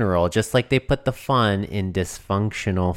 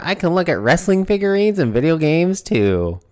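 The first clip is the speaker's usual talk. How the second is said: voice cracking; 7 semitones higher than usual. Real, not acted. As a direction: voice cracks high with excitement. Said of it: "Your voice cracked a little".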